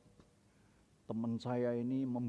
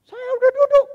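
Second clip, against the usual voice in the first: loud, high, snooty claim; haughty assistant; 21 dB louder, faster than usual. Retold or imitated. Rude assistant claiming the pastor's restaurant seat, haughty. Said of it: "Orang itu gini".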